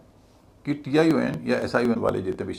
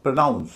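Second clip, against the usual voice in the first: "Pronounce" with a light first syllable and heavy "nouns"; stress on second syllable; 4 dB louder than usual. Shown as a demonstration. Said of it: "nouns भारी है". ("Pronounce" में "nouns" भारी, पहला हल्का)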